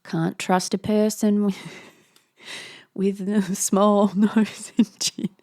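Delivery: giggling through a joke; laughing aside. Real, not acted. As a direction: joke about it while laughing. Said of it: "I'm just kidding".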